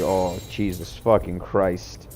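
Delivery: slow, drawn-out disbelief; slowly savored swearing, retold; retold awe. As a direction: draw out each word in mock astonishment.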